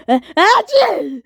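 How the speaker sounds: sneeze. Acted. Sneezes again.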